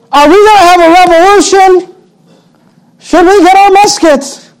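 high-pitched, hammed-up eagerness; mock eager disciples. Preacher playing disciples asking Jesus about revolution, mock-eager. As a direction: ask like overexcited would-be rebels.

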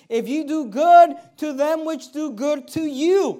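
preacher pressing the verse, loud; emphatic reading. Shown as a demonstration.

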